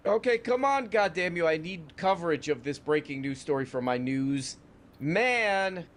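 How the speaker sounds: high, hammed-up badgering; mock exasperated demand. Host demands breaking news from his newsman, mock exasperation. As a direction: demand it with rising, playful impatience.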